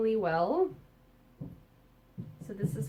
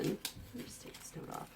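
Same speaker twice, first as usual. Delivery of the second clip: whispering; 6 semitones lower, 11 dB quieter than usual. Real, not acted. Aside while removing note.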